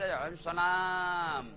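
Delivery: slow, lazy mock reply; congregation's sluggish reply. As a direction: answer the salam half-heartedly.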